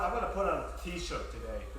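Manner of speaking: ordinary delivery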